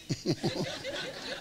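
high, soft chuckling; real laughter. Real, not acted. Preacher amused mid-sermon, high soft laughing. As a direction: laugh quietly in a high pitch.

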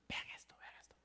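hushed whisper to the crowd. Real, not acted. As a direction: whisper it like a secret trick. Magician hushes audience for trick.

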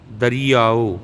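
ਦਰੀਆਓ alone, long ee. Shown as a demonstration. Teacher repeats for learners, ਦਰੀਆਓ with long ee. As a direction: model ਦਰੀਆਓ as da-ree-aa-o. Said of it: "ਦਰੀਆਓ ਬੋਲਣਾ".

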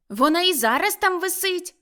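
put-on high, loud boy's voice; bright-pitched youngster's answer; little boy. A narrator's reading. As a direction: call up like an eager lad. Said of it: "сказав хлопчик".

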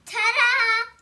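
high, loud triumphant ta-da; child announcing the trick with a flourish; showy ta-da. Acted. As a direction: sing out the reveal like a magic trick. Child reading the reveal.

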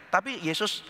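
usual speaking voice

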